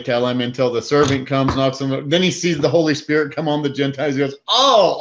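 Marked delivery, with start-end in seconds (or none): 4.5-5.0 sudden high, loud oh of realization; a louder, higher oh as it clicks; dawning realization. Retold or imitated. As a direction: let it dawn on you all at once. Realization dawns on Peter.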